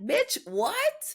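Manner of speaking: shocked and offended at the flag; indignant disbelief. Retold or imitated. Reacting to TikTok flagging her, high indignant squeal. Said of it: "and I'm like".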